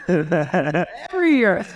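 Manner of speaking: slow, laughing it out. Real, not acted. Laughing to the host about hoping every year. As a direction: chuckle through the words, drawn out. Laughing at his own yearly hope.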